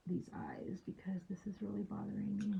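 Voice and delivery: whispered to herself while working; murmured aside while painting. Real, not acted. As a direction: breathe it under the breath, just for yourself. Muttering while fixing the eyes.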